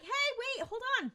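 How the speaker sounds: high-pitched mock call after someone; retold calling out. Dean calls to Sam running off, calling out.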